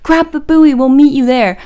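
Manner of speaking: mock urgent shout; calling out. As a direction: yell it to a friend in the sea. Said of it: "And then they're like".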